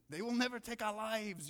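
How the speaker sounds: high-pitched, bad Scottish accent; amateur Braveheart-style Scottish accent; terrible Scottish accent. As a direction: do a badly faked Scottish battle speech. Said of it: "that was a terrible accent".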